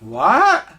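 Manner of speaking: rising, baffled "what?"; surprised. Retold or imitated. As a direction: let it swing upward in confusion. Taken aback by husband's remark.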